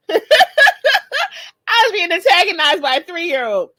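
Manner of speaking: loud, high, drawn-out words through laughter; slow, high laughing complaint; laughing hard. Real, not acted. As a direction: squeal it out while cracking up. Laughs at her own story.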